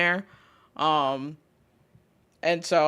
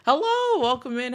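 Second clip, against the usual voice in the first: high greeting; 7 semitones higher, 3 dB louder than usual. Acted. Greeting a new viewer.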